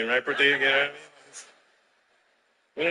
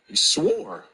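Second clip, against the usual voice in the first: whispered aside; 3 semitones higher than usual. Retold or imitated. Aside after swear.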